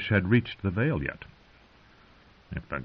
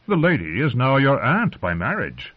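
higher-pitched, appalled protest; shocked; 6 semitones higher, 3 dB louder than usual. A narrator's reading. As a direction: object in disbelief. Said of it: "Sir Kevan said in a shocked voice".

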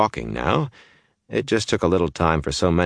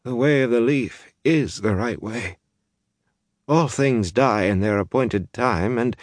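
higher, slowing dazed voice; numb, faraway believer; dull, dazed; 7 semitones higher than usual. A narrator's reading. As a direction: recite your creed dully, then trail off. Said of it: "looked up at Perrin dully".

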